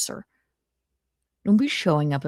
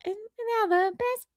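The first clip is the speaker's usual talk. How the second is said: much higher, slower voice; Chrissy Mayer impression. Imitating streamer Chrissy with a high, thin voice. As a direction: lift the voice up high as a light female impression. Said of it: "Chrissy Mayer impression".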